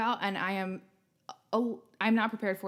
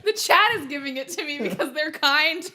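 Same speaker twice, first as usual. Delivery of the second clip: talking through laughter, higher pitched; laughing; 7 semitones higher, 3 dB louder than usual. Real, not acted. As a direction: laugh helplessly while admitting defeat. Laughing at own failed frog impression.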